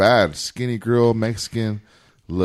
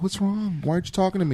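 put-on high, soft girlfriend voice; imitated girlfriend; 8 semitones higher, 6 dB quieter than usual. Girlfriend asking her silent man what's wrong, softly imitated. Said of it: "And she's like".

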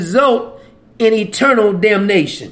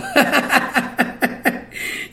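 voiceless, tickled cackle; real laugh, softer and breaking up; 3 semitones higher, 7 dB quieter than usual. Real, not acted. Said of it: "I just be laughing".

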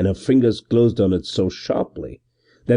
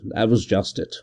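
ordinary delivery

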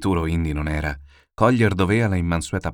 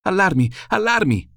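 high, loud cry of alarm; urgent alarm call, high and loud; alarmed shout; 12 semitones higher, 5 dB louder than usual. Acted. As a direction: yell the warning to the house. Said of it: "ei tosto gridò".

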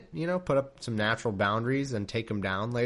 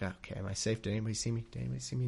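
a hint of a hushed, nervous Abram, softer; whisper-checking if anyone saw; 2 semitones lower, 9 dB quieter, much faster than usual. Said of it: "He did that thing where he's like".

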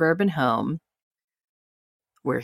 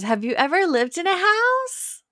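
much higher mock voice, condescending; mocking Sharna; 13 semitones higher than usual. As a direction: squeak it out like a patronizing host. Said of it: "Sharna goes".

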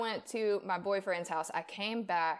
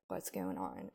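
much lower, soft, uneasy question; hushed alarm; 12 semitones lower, 8 dB quieter than usual. Retold or imitated. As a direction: ask it quietly, sensing something's wrong. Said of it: "I was like".

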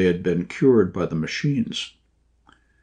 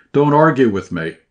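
near shout; 4 semitones higher, 5 dB louder than usual. A narrator's reading. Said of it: "he almost shouted".

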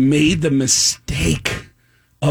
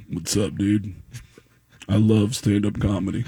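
a lower, softer, laid-back dude voice; Brett Favre; 8 semitones lower, 6 dB quieter than usual. Retold or imitated. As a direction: drop the voice low and easy like a cool athlete. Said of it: "I hear Brett Favre go".

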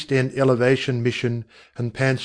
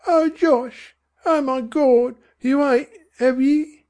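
high, crying mother; horrified mother; 14 semitones higher, slower than usual. A narrator's reading. Mother pleading with husband, horrified and crying. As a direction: beg him to deny it.

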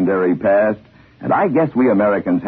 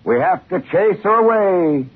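slower, higher folksy conductor; conductor's voice; 10 semitones higher than usual. Retold or imitated. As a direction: drawl it out like a country conductor. Narrator voices the train conductor.

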